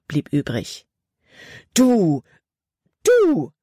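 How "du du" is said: sputtering, much louder and higher; spluttering anger. A narrator's reading. Girl slapped with snow sputters at the boy, furious. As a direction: spit the words out in rising fury. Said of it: "sprühte sie".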